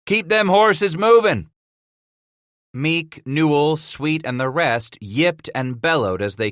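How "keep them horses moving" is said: high, loud holler to his men. Acted. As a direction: holler over the herd. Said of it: "Scratch hollered".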